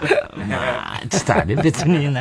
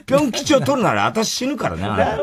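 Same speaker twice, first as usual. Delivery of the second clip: mock boss voice; 13 semitones higher than usual. The lady boss's ultimatum, a high hammed-up voice. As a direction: overplay it like a wounded wife.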